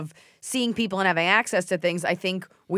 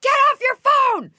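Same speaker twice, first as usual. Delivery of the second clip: full, high-pitched shout; sudden piercing holler; reenacted yell; 7 dB louder than usual. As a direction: yell it out the car window at another driver. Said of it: "and I yelled at her".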